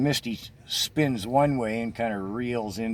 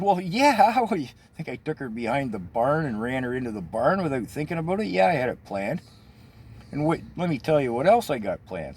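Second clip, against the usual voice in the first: higher, louder, laughing smug answer; 5 semitones higher, 4 dB louder than usual. Retold or imitated. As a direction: brag with a chuckle. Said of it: "I said".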